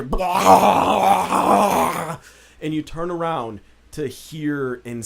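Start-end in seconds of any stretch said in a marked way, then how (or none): 0.0-2.2 loud, long zombie-horde roar; monstrous roar. Acted. Game master voicing zombies attacking behind players, roaring cry. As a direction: let out a raised, drawn-out yell. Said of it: "you just hear".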